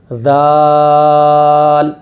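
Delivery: ذال held very long with tongue tip on front teeth. Shown as a demonstration. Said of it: "زبان کی جو نوک ہے آگے والی".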